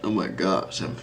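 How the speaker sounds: talking through a yawn. Real, not acted. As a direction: yawn while speaking. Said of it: "I'm yawning so hard".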